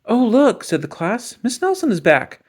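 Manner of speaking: put-on high, loud, eager kid voices; excited kids. A narrator's reading. The class pretends Miss Nelson is back, loud and eager. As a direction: sell the fake delight.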